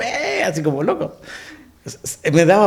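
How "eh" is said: a high squeak held back from a shout; stifled mock yell. Husband stifling a goal cheer among Argentinians, high squeak held back. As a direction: swallow the cheer, half-voiced and high. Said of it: "No podía gritar, así como".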